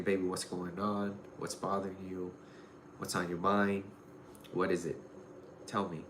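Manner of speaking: a hint of a soft, comforting dad; lightly voiced father coaxing his crying girl; gentle father.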